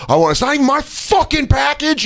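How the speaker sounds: high-pitched exasperated complaint; outraged yell. Retold or imitated. Customer yelling over someone else's package, outraged high yell. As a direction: rail at the driver.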